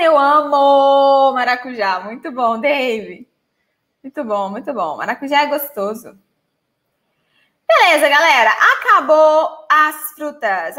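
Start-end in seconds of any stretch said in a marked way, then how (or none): 0.0-2.0 quoting a viewer. Retold or imitated. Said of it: "o David dizendo". 7.7-10.8 laughing. Real, not acted. Laughing wrap-up of fruits.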